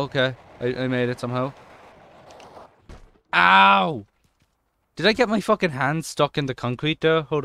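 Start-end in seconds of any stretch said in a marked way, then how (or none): 3.3-4.1 high, loud drawn-out yelp; mock pained ow. Real, not acted. Gamer watching the skater crash, mock pained yelp. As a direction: yelp as if you felt the crash.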